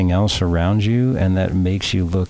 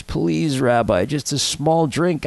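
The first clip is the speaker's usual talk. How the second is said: higher, slow pleading; pleading in tears; 7 semitones higher, slower than usual. A narrator's reading. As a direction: beg the rabbi, close to tears. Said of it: "poor Maury Schwartz is in tears".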